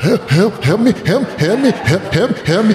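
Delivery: high, hiccuping cries for help; imitating a bawling friend begging for help; mock sobbing. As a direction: plead in hammed-up, choked little sobs. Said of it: "really crying right now?".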